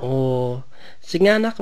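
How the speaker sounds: normal talking voice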